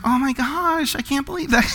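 soft, high mock-sympathetic voice; gushing sympathizer. Preacher mimicking the mercy gift to his church, gushing sympathy. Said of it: "I can't even say it with a straight face".

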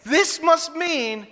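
high-pitched acted realization, slowing down; sudden realization. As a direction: stop yourself as it dawns on you. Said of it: "I'm like".

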